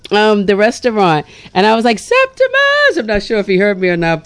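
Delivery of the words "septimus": high, drawn-out call across the street; shouting a name. Retold or imitated. Calling out to the chef while driving past. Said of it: "I shouted out to him".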